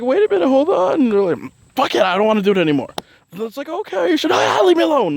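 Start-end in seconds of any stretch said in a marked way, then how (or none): 0.0-1.1 high, loud startled voice; flustered protest. Retold or imitated. Her stopping a rushing Aries friend, high and flustered. 1.7-2.9 fast, fed-up brush-off; impatient Aries moon. Retold or imitated. Aries moon friend quitting the plan, quick and fed-up. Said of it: "my impersonations". 3.7-5.2 squeaky high brush-off; high mock dismissal. Waving off an Aries moon friend, high and silly.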